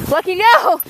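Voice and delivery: loud firm no; scolding the dog. Real, not acted.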